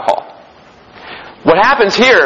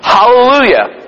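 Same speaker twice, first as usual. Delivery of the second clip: higher-pitched, restrained cry of praise; mild shout; 7 semitones higher, slower than usual. Acted. Preacher voices the multitude in heaven, mild shouted praise.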